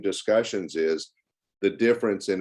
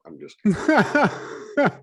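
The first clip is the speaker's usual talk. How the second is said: real laugh through the words, pitched higher; chuckling as he takes the joke back; 13 semitones higher, 4 dB louder, much slower than usual. Real, not acted. Comedian laughing off his own joke to the hosts, laughing. Joking about plugs, then laughing.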